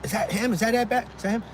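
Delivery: high-pitched murmurs of recognition; mock chorus of excited questions; several onlookers' voices. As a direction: imitate curious passengers asking each other. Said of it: "three or four voices".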